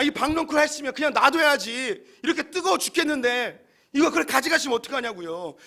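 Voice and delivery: sulking Jonah yelling at God that he is right; Jonah's angry protest. Acted. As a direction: play Jonah shouting back at God. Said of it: "뭐라고 이야기하면".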